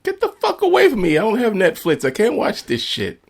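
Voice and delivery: high, loud exasperated yelling; incredulous outburst. Retold or imitated. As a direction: shriek it out in disbelief. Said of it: "I was like".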